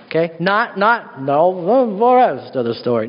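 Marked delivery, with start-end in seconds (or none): 1.2-3.1 Paul Harvey. Shown as a demonstration. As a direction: drop your voice like an old-time radio host. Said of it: "do my best Paul Harvey impression".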